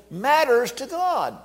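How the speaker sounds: drawn out, high and slow; slow emphatic stress. Real, not acted. Preacher to congregation, slow emphatic stress. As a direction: lean hard on matters to God.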